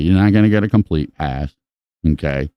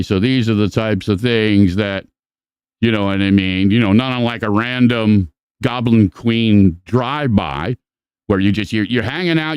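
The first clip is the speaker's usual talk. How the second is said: ordinary talk, nothing marked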